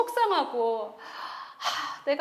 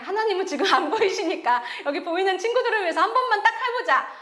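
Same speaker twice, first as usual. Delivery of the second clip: talking herself into it, higher and louder; raised, pushing pep talk to oneself; self-urging; 6 semitones higher, 5 dB louder than usual. Retold or imitated. Singer to herself before performing for friends, urging herself on.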